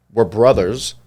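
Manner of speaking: normal talking voice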